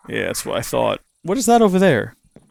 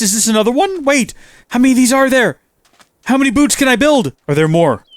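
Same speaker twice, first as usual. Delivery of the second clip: eager excitement; 7 semitones higher than usual. Real, not acted. Hunting fallen stars eagerly.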